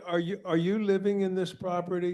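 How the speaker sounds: loud. Real, not acted. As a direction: speak up firmly over a bad line. Chair speaking up to faint caller.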